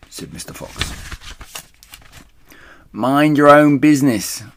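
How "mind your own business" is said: high, loud rat voice; angry shriek. A narrator's reading. Rat accused of being drunk, angry shriek. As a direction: shriek back, affronted. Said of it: "shrieked Rat".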